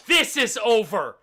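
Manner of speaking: loud, final declaration; angry queen. Acted. Half-elf queen quitting the peace talks, loud indignation. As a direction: cut the talks off loudly.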